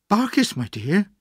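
gently chirpy, high-pitched address; almost cheerful. A narrator's reading. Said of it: "she said almost cheerfully".

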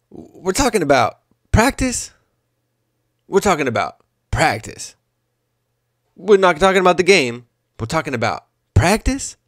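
lightly voiced exasperated press-conference rant; a hint of Iverson repeating himself in disbelief; mock-incredulous athlete at the podium, only lightly put on; Allen Iverson impression. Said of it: "That's my Allen Iverson impression".

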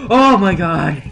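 loud high cry of alarm; frightened shout. Real, not acted. Monster appears.

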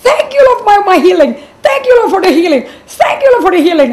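high, loud exultant praise; joyful thanks. Retold or imitated. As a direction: cry out overjoyed thanks to God. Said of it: "I was laughing joyfully".

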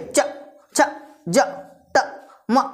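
च, छ, ज, त, म each cut off abruptly; short separate jerks on च, छ, ज, त, म; clipped letters. Shown as a demonstration. Teacher drilling half letters, च, छ, ज, त, म clipped short. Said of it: "झटके से बोलना है".

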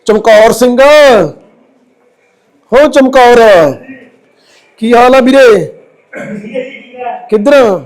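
loud call into the house to get his attention; calling out loudly to a withdrawn brother to come out. Real, not acted.

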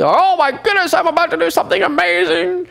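high, showy bragging; boastful voice. Pretend. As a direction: brag like a show-off. Boasting before a battle.

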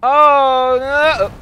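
loud, high-pitched "oh no"; dismayed cry. Real, not acted. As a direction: cry out in alarm, stretched out. Startled cry in game.

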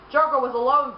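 ordinary delivery

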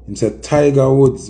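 normal talking voice

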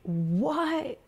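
high, soft, rising-pitched squeak; stunned disbelief. Real, not acted. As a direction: squeak it out in shock. Shocked at the video's ending.

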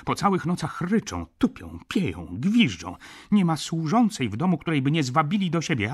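high, loud, agitated listing; indignant complaint. A narrator's reading. Baroness complaining to the judge about lodgers, indignant.

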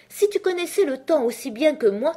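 high, loud, emphatic character voice; lecturing Hatter. Acted. Hatter explains Time to Alice, emphatic and loud.

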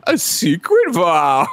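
slow, drawn-out hammed-up reveal; mock dramatic. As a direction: stretch it out with fake mystery. Mocking Bruce's melodramatic line.

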